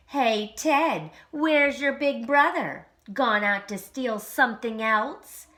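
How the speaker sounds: loud mocking schoolyard jeer; taunting. A narrator's reading. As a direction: tease him loudly as a bully. Kids teasing Ted.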